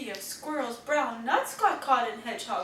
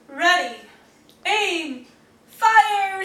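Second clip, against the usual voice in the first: louder, rising command; 5 semitones higher, 9 dB louder, much slower than usual. Acted. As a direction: bark it out like a captain. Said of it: "she commands".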